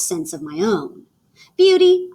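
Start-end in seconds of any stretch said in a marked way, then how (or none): 1.6-2.1 bold call. Acted. Girl addressing her bike.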